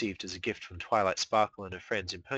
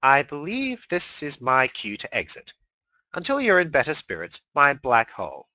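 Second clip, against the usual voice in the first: high, loud mock-polite; smug farewell; 6 semitones higher, 8 dB louder than usual. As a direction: tip an imaginary hat. Discord's smug exit line.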